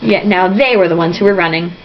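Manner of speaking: ordinary delivery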